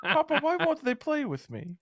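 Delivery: put-on high, plaintive little boy voice; a lonely boy calling for his papa; sad child Milo. Milo, high and forlorn, calling out to Papa.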